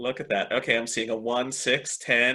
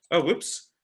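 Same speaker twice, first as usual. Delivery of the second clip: ordinary talk, nothing marked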